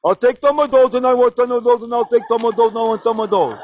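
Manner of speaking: high, silly put-on voice; idiot voice demo. Shown as a demonstration. Coaching his pal on faking an idiot, silly high voice. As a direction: show him the silly idiot voice. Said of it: "you talk like this".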